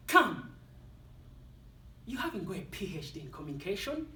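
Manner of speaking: a sharp mocking inner critic; mock the speaker as his doubting self; negative inner voice. Self-talk belittling his accent, mocking inner critic. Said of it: "negative self-talk that talks to us".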